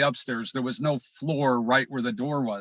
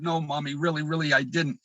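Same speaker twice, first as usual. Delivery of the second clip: ordinary delivery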